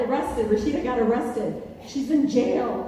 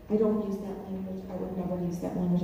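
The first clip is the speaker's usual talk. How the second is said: low, softer voice of a disapproving elder; prim senior colleague; 5 semitones lower, 5 dB quieter than usual. Retold or imitated. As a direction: lightly imitate a senior lady's quiet reproof. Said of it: "my seniors who".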